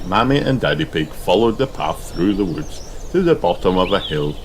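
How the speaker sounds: ordinary delivery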